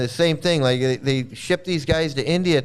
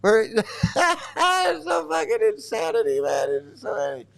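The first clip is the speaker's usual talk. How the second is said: laughing disbelief; 6 semitones higher than usual. Real, not acted. Laughing at temple rules.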